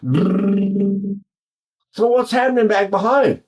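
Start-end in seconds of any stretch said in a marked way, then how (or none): 0.0-1.2 buzzing lip trill demo. Shown as a demonstration. Voice teacher demonstrating a lip trill for students. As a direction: trill the lips on a steady tone. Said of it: "my tongue was busy".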